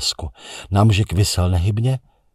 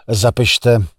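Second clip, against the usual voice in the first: a louder dictating command; said loudly; 4 semitones higher, 5 dB louder than usual. A narrator's reading. The narrator ordering the operator, louder. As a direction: call it out firmly to the operator. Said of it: "pravím hlasitě".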